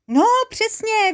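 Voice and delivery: very high, drawn-out exclamation; stretched high-pitched cry of yes; exclaiming agreement. A narrator's reading. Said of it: "vykřikl Mecháček Pecháček".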